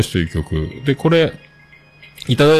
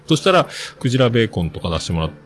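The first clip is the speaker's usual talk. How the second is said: usual speaking voice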